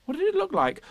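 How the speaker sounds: put-on high boyish questioning; lifted, curious child's voice; Christopher Robin. Christopher Robin asking Piglet, high and curious.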